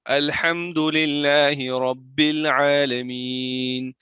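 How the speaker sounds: slow, measured recital with long held vowels; Quran recitation. Shown as a demonstration. Teacher modelling the verse, slow drawn-out recitation. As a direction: recite the verse slowly, drawing out the endings.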